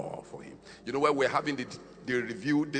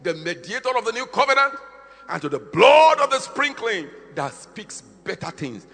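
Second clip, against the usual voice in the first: loud, high preached verse; shouted scripture; 8 semitones higher, 12 dB louder than usual. Acted. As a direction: proclaim the verse like a herald. Quoting scripture at full force.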